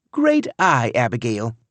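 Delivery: wife praising the find; wife cheering. A narrator's reading. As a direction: pounce on the discovery. Tammy praises Abigail.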